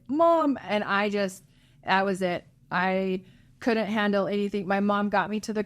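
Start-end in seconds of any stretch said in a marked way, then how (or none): 0.1-0.6 calling out. Retold or imitated. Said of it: "And I was like".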